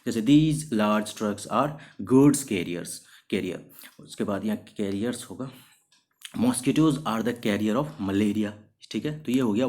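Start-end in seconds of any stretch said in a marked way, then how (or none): 0.2-2.9 each word drawn out for learners; slow example sentence. Shown as a demonstration. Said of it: "example sentence read for students". 6.4-8.5 slow, clear dictation for learners; slow example sentence. Shown as a demonstration. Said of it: "example sentence read for students".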